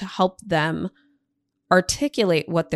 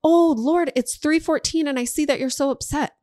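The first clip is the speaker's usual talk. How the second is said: bigger mock surprise; 8 semitones higher than usual. Shown as a demonstration. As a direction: overplay the shock this time. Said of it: "Day two".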